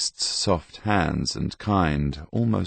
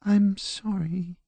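very high, slow, meek apology; small, submissive girl's voice; meek little girl; slower than usual. A narrator's reading. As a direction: apologise timidly in a tiny high voice. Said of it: "she said meekly".

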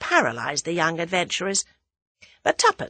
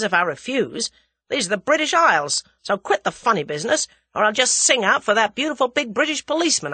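blustering American, mildly raised voice; Hersheimer, suspicious American; 4 semitones higher, 4 dB louder than usual. Acted. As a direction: warn them off like an indignant American.

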